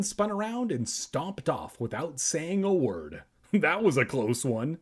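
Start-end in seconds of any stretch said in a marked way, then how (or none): none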